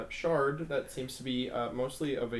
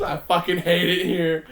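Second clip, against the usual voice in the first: exasperated shout; 6 semitones higher, 15 dB louder than usual. Retold or imitated. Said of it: "Sophie's losing it, just".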